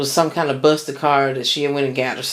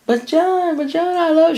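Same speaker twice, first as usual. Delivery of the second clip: much higher imitated girlfriend, begging; pleading girlfriend. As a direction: squeak out her pleading like a mimic. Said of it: "She was begging and pleading and crying".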